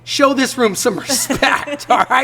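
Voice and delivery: high, drawn-out mock indignation; mock scolding. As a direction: scold the bandmates in a hammed-up high voice. Mock scolding messy bandmates in story.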